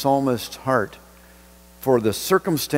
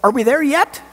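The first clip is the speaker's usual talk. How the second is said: much higher, excited question rising at the end; eager; 12 semitones higher, 3 dB louder than usual. Shown as a demonstration. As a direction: bounce it up like you can see the destination. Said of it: "a bit more like".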